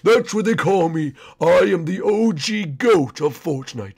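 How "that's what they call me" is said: ordinary talk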